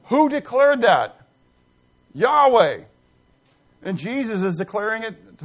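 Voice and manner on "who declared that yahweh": emphatic proclamation. Acted. Preacher's emphatic climax.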